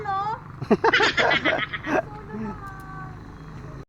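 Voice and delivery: squeaky high laugh. Real, not acted. Laughing before the climb.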